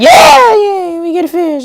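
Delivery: very high squealed little-sister cheer; loud shrill squeal easing into high cheer; excited sister. Retold or imitated. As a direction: mimic a kid shrieking with delight. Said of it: "My sister's like".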